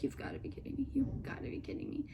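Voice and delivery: soft, unvoiced whisper of disbelief; horrified whisper. Teen dreading being introduced to her crush, whispering. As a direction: mutter it under your breath in dread. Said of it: "I was like".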